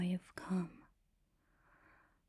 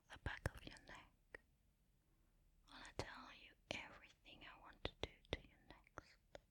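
barely audible, voiceless whisper; whisper into the ear; 2 semitones higher, 17 dB quieter than usual. Acted. Partner curled up behind the listener, whispering into the ear. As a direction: whisper it very softly, right up close. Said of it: "No matter what I whisper into your ear".

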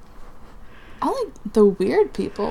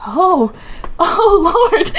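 high, loud mock swoon, breaking into laughter; swooning reaction; 9 semitones higher, 9 dB louder, much slower than usual. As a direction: react as if overwhelmed, much higher and louder. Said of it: "And I was just like".

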